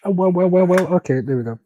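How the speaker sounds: ordinary talk, nothing marked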